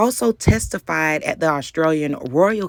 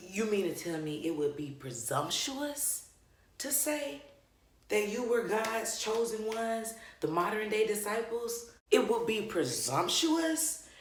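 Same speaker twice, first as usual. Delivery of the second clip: incredulous questioning; 7 semitones higher, 15 dB quieter than usual. Real, not acted. Reacting to his claim it would be presumptuous, incredulous. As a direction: question it in high, softly spoken disbelief. Mock disbelief at the clip's answer.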